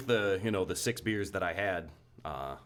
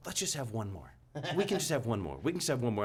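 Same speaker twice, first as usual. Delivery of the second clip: higher-pitched imitation of coaxing; neighbor's wife; 4 semitones higher than usual. Retelling neighbor's wife pushing one more beer, higher coaxing voice. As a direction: lightly mimic someone repeatedly urging another round. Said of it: "But she kept saying".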